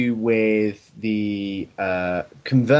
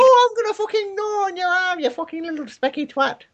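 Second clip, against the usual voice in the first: much higher, squeaky mock Scouse rant; Steven Gerrard impression. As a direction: screech it like a cartoon footballer. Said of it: "And that's Steven Gerrard".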